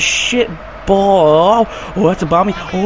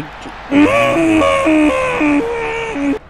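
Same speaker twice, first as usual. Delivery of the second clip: anguished cry; 14 semitones higher than usual. Real, not acted. Opponent attacking, despair.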